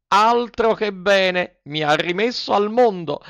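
high, drawn-out puppet cheer; elated Pinocchio. Acted. Cured puppet praises the fairy's medicine, elated. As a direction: exclaim it high, stretching the first words.

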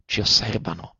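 bisbiglio urgente; zitta, urgente. A narrator's reading. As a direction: zittiscila piano, che osservano. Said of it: "la interruppe vivamente". (un bisbiglio di cospiratore)

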